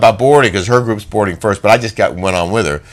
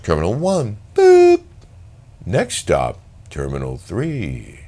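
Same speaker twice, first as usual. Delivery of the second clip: train announcement voice; 4 semitones lower, 6 dB quieter, slower than usual. Retold or imitated.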